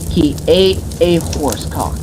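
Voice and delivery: ordinary delivery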